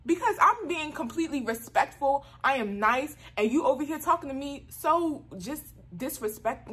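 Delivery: worked-up, retold self-defense; acted indignant protest. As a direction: argue back, affronted at the disrespect. Acted protest at being disrespected.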